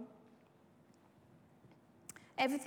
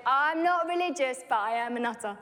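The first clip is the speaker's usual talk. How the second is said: cheeky punchline; 7 dB louder than usual. Retold or imitated. Punchline to the woman.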